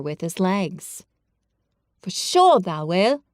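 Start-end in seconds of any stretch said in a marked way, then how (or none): 2.0-3.2 lightly voiced Dickon, firm and sure; a hint of a sturdy moor lad; stout Yorkshire reassurance. A narrator's reading. Dickon reassures Colin, stout and firm. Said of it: "he said stoutly".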